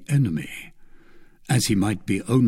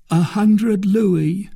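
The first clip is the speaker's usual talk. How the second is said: high, slow, drawn-out admission; name the price in the captive's high voice; confessing bandit; 9 semitones higher, 4 dB louder, much slower than usual. A narrator's reading.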